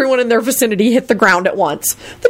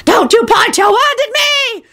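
high, drawn-out hag screech; slow, piercing high threat; screaming hag; slower than usual. A narrator's reading. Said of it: "screamed the hag".

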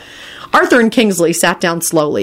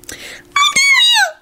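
extremely high squeaky outrage; tiny squeak; much slower than usual. A narrator's reading. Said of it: "squeaked in the highest-pitched voice".

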